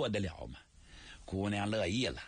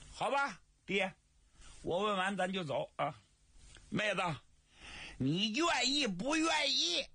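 put-on higher, coaxing young man; wheedling brother; 11 semitones higher than usual. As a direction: bargain with her, half teasing. Li Jun sets a teasing condition.

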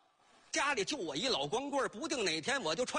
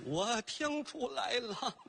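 ordinary talk, nothing marked

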